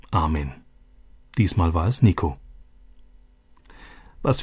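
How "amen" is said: soft, low and heartfelt amen. Acted. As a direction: say it from the heart, quietly. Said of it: "aus tiefstem Herzen". (leises, tief empfundenes Amen)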